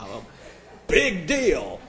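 normal talking voice

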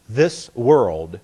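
"this" and "world" slightly parted; slowed THIS WORLD. Shown as a demonstration. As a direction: give "this world" two separate, deliberate beats. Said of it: "to slow down your reading".

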